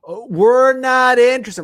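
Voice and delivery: loud, high, drawn-out brush-off; hammed-up shrieky imitation of a customer saying no; mock refusing housewife. Lady at the door turning away sellers, exaggerated loud refusal.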